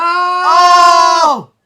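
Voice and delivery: high, loud shriek; surprised shriek. Real, not acted.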